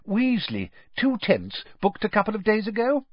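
high, bright and chatty; eager wizard. A narrator's reading. Mister Weasley booking tents, high and eager. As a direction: rattle it off cheerfully.

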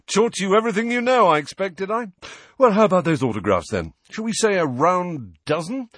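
high, quick, self-satisfied chatter; vain celebrity. A narrator's reading. Former teacher pressing autographs on pupils, high and exuberant. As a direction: rattle on with showy eagerness. Lockhart brightens, pushes autographs.

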